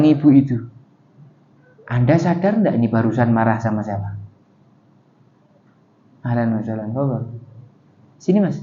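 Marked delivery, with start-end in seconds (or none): 1.9-8.6 hushed warning. Acted. Neighbours quietly warning the mother.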